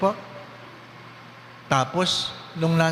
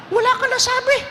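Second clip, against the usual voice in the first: high shrill mock-mother scold; scolding parent; 4 dB louder than usual. Priest mimicking a scolding mother. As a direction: snap at the child like a fed-up mother.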